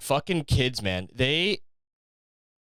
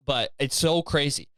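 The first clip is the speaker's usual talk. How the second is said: ordinary talk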